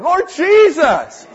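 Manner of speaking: slow, loud cry of Lord Jesus; calling out to the Lord. Retold or imitated. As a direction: stretch it out and call loudly to heaven. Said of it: "I started calling on the Lord".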